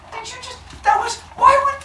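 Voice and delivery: hammed-up flabbergasted villagers; shocked sputter. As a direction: squeak out outraged confusion. Humans shocked by fire stomped.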